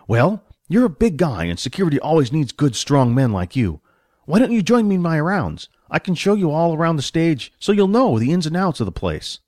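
welcoming stage manager. Acted. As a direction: invite the new recruit along in a lifted voice. Manager invites Billy on rounds.

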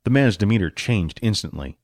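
ordinary delivery, nothing marked

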